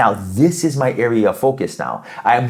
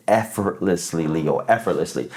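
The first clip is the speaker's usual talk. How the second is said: soft reassurance; 6 semitones lower, 5 dB quieter than usual. Real, not acted. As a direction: let the word settle gently on the listener. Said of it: "closing reassurance".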